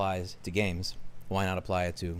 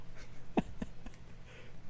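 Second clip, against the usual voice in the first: breathless, voiceless chuckle; whispered laugh; 11 dB quieter than usual. Real, not acted. Laughing at her own joke.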